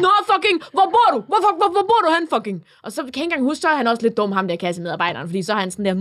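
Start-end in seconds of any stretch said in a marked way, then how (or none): 0.1-2.6 louder, higher mock street tough; imitated fight-picking. Imitating a boy challenging a cashier, aggressive. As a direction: demand it like a boy itching for a brawl. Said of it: "lægge op til et slagsmål".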